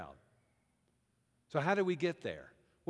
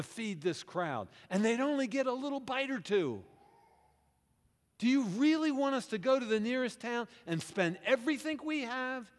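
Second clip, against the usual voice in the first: emphatic protest; 7 semitones higher than usual. Acted. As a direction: push back hard at Jesus, incredulous. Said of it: "as they respond emphatically".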